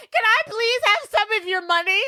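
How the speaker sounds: put-on high, pleading girl; mock begging. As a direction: whine for money sweetly. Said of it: "she's like".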